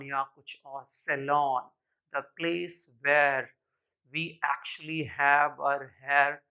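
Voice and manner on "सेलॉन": सैलून said "SE-lawn"; long "aw" ending in सैलून; correct pronunciation. Shown as a demonstration. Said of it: "न कि". (सैलून का उच्चारण "सेलॉन")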